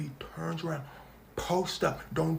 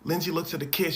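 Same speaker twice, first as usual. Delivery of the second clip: normal talking voice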